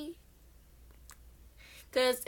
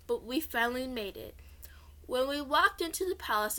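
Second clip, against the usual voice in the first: whisper. Acted. Whispered narration.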